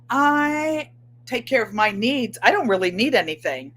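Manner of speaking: ordinary talk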